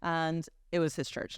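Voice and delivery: normal talking voice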